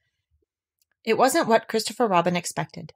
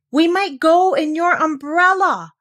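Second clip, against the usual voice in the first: high, louder, slow; insistent repeat; 10 semitones higher, 7 dB louder, slower than usual. A narrator's reading. Pooh repeating his idea, higher and louder. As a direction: say it at last with emphasis. Repeated loudly third time.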